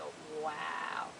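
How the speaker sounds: low, very soft, trailing wow; stunned reaction. Retold or imitated. She hears he'll be back, low stunned murmur. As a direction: draw it out low and let it fade.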